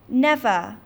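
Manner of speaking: loud, firm refusal; flat out refuse; defiant shout. Acted. Mowgli defies the threat.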